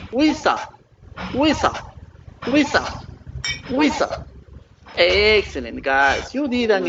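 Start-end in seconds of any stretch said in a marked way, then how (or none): 0.1-4.3 "Wisa" said high with a falling tune, over and over; model pronunciation. Shown as a demonstration. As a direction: lead the class in "Wisa", pitched up high. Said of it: "let's pronounce together the last word".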